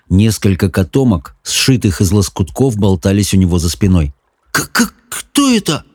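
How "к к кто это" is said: high, slow, stuttering question; stammering whisper. A narrator's reading. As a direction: stutter on the first sound in a hushed, high voice. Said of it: "заикаясь, прошептал Саймон". (заикающийся шёпот)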